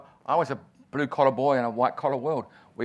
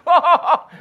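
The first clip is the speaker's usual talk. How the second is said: laughing; 13 dB louder than usual. Retold or imitated. Laughing inside the retold job interview.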